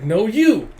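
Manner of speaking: high, loud comeback; mock-indignant squabble; childish retort. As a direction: fire it back like a squabbling kid. Acted childish quarrel.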